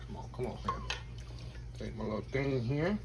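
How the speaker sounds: ordinary talk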